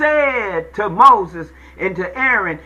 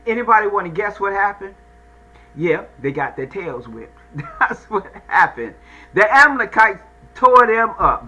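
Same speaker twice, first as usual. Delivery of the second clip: normal talking voice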